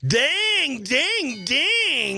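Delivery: excited host cheer. Acted. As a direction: ring out the win like a quiz host. Host celebrates winning caller.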